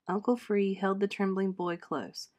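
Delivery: ordinary delivery, nothing marked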